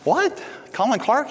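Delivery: high-pitched mock disbelief; incredulous. Pastor reacting to friend not knowing Colin, incredulous. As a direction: retell astonishment, pitch leaping way up.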